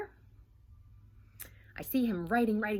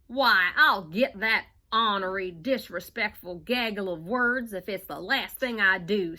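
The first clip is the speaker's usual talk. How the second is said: louder put-on cowboy voice; 6 dB louder than usual. Cowboy Cal vowing to catch the sentence, loud cowboy voice. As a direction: bluster like a tough old cowboy. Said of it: "said Cowboy Cal".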